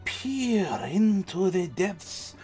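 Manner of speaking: very slow, drawn-out mystic seer voice; crystal-ball fortune-teller. Acted. DM shows players a crystal-ball reading, slow mystical fortune-teller. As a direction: peer into the future, slow and swooping. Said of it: "she has a crystal ball in her tent".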